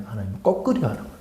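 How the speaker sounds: hushed rapid echo of the point; quick quiet aside. Acted. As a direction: mutter it again under your breath, quickly. Quick low repeat after statement.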